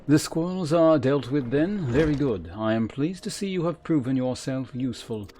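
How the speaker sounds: voiced game mentor, formal and measured; stern quest giver. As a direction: read it as a haughty trainer addressing a recruit. Reads quest giver's dialogue aloud in character.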